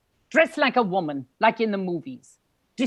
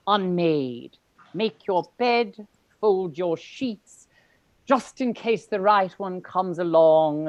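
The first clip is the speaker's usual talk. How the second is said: joyful poetry recital; 2 semitones higher, 4 dB louder than usual. Acted. Fez reciting his bad poetry while folding sheets, hammed-up and joyful. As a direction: declaim bad poetry with gusto. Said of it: "He recites his bad poetry joyfully".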